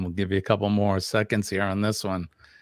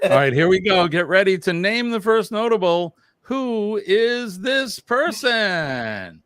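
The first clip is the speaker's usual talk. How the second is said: upbeat host voice, higher pitch; game show announcer voice; 9 semitones higher, 4 dB louder than usual. Pretend. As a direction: kick off the round like a quiz host. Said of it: "when you do that game show voice".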